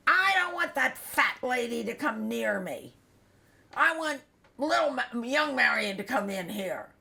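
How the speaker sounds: loud, high, insistent protest; imitating a father's loud tantrum; retold angry yelling. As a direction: holler the refusal like a stubborn patient. Retold father refusing the nurse.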